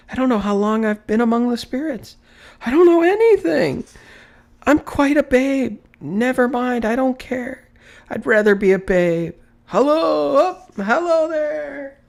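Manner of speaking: very high, loud, fast babbling joy; childlike glee. A narrator's reading. Scrooge not caring what day it is, giddy as a babe.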